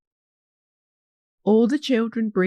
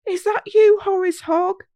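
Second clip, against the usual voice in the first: high excited yell, louder; children yelling; 11 semitones higher, 6 dB louder than usual. Acted. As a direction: call out together into the dark. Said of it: "yell out all the children together".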